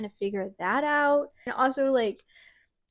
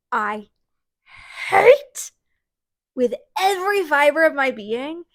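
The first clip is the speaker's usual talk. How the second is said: hate punched out much higher and louder; stressed hate; 4 semitones higher, 6 dB louder than usual. Real, not acted. Reviewer to viewers, hammering hate with loud emphasis. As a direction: lean hard on hate, then stay loud through the rest. Ranting about a hated trope.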